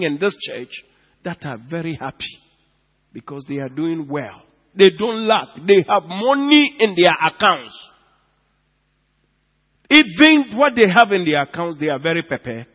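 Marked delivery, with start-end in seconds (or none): 4.7-11.8 loud, pressing preaching lines. Acted. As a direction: drive it home to the congregation. Preaching at full force.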